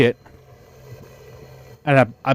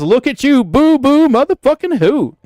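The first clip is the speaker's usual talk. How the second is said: high, loud mocking taunt; sing-song jeer at the crying kid, pitched way up; mocking clerk taunt; 9 semitones higher, 3 dB louder than usual. Clerk taunting the sprayed kid, high loud mocking jeer. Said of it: "And he says".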